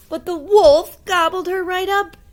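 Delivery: plain reading voice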